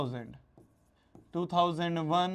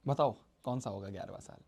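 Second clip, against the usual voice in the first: quiet repeat; 10 semitones lower, 7 dB quieter than usual. Acted. Teacher repeats question to class.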